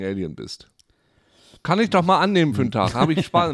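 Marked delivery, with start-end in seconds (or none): 1.6-3.5 lauter, höher, begeistert. Real, not acted. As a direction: ruft es freudig aus. Begeistertes Ausrufen. (begeistert und laut)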